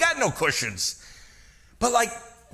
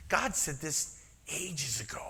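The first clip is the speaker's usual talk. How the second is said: much softer whispered aside; 2 semitones lower, 10 dB quieter than usual. Real, not acted. Preacher comparing Sun Tzu with God, a soft whisper. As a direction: let them in on it quietly.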